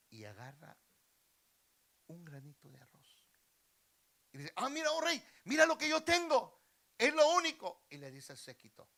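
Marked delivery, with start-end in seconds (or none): 4.6-7.9 high, loud acted exclamation; beggar's outcry. Beggar showing his grain of rice, crying out. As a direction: call up to the king at full voice.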